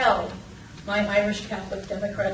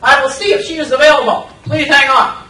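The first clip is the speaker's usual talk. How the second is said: crisp sergeant; 10 dB louder, faster than usual. Retold or imitated. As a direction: bark it out like a drill-sharp recruiter. Sergeant voiced in story.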